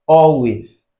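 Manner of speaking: "always" with a high, loud AL; stress on first syllable. Shown as a demonstration. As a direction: punch the AL in "always". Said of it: "you have it on all".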